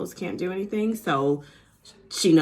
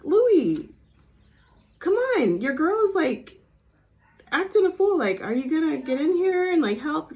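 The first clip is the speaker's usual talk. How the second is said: higher, louder exasperated pleading; exasperated plea; 10 semitones higher, 3 dB louder than usual. Retold or imitated. Urging silent boyfriend to step in, higher exasperated plea. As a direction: urge him to step in, pitched up. Speaker urging Louis at scene.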